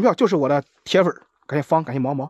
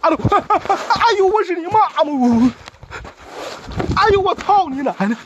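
laughing yell at drone; 13 semitones higher than usual. Real, not acted. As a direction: bellow up at the drone, half laughing. Yelling at drone again.

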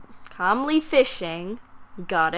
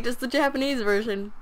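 usual speaking voice

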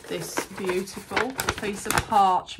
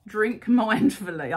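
slowed, louder, breaking into a laugh mid-phrase; amused laughing read-out; 2 semitones higher, 7 dB louder, slower than usual. Real, not acted. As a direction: giggle through the card. Laughs reading card about drinking.

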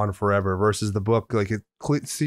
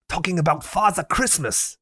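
high-pitched German-accented imitation; 7 semitones higher than usual. Imitating the sweet German friend for co-host, high German accent. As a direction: ham up a sweet German's English. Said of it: "the accent I'm doing is, like, exactly him".

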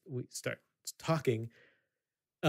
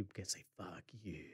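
breathy whispered curse, barely voiced; 6 semitones lower, 17 dB quieter than usual. He curses people who treat him badly, in a whisper. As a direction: hiss it as a whisper under the breath. Retold man cursing rude people.

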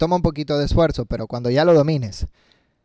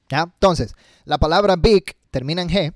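ordinary talk, nothing marked

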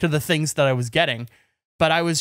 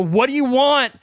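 higher-pitched, mildly raised retold yell; 7 semitones higher, 4 dB louder than usual. Retelling yelling on waking at night, mild yell. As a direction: act out demanding it, pitched up. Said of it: "I yell".

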